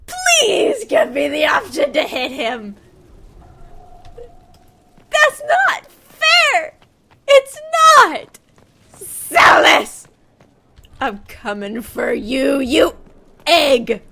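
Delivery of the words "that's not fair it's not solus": high, loud, slow protest; drawn-out whiny yell; indignant shout. Real, not acted. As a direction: wail that it's unfair. Streamer protesting game events.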